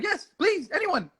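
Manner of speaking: high mock eager plea; pleading Lisa. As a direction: beg sweetly for anyone. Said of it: "She was like".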